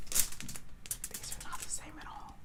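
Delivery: hushed whisper of disappointment. Real, not acted. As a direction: breathe it almost silently. Comparing mismatched eyes.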